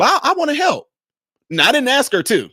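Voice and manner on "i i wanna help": higher, eager imitation of his wife; husband mimicking his wife volunteering, pitched up; wife's voice. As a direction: lift it up like a keen wife offering help. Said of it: "Guess what my wife did?".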